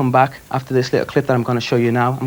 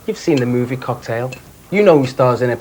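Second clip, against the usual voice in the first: usual speaking voice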